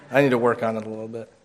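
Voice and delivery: normal talking voice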